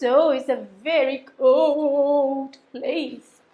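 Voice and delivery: usual speaking voice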